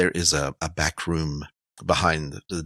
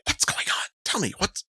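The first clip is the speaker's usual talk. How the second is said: hushed, high woman's voice; urgent whisper; 11 semitones higher, 7 dB quieter than usual. Acted. Woman pressing Willard for answers, in an urgent whisper. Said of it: "the woman's kind of like".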